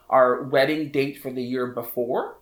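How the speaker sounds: ordinary talk, nothing marked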